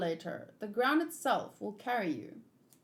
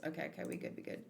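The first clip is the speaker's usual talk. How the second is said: breathless whisper to herself, calming down; whispered reassurance; 5 semitones lower, 10 dB quieter, much faster than usual. Real, not acted. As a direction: hush it, talking yourself down. Calming herself after the shock.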